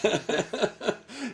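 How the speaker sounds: real high burst of laughter; hearty laugh. Real, not acted. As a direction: laughs out loud, amused. Laughing after his joke.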